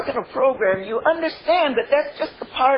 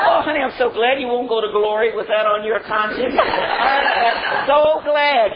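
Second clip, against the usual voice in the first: laughing; 3 semitones higher, 4 dB louder than usual. Real, not acted. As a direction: tease him lovingly through giggles. Laughing with dying husband.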